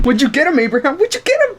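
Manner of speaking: very high, eager question; high squeaky voice of a viewer asking; squeaky audience voice. Pretend. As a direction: play a curious fan squeaking. Voicing the audience.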